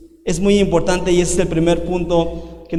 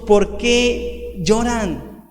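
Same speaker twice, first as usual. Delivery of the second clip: gentle divine question; 2 semitones higher, much slower than usual. Acted. God asking his suffering children, slow and tender. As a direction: ask tenderly, like a father consoling. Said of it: "Dios diciéndonos".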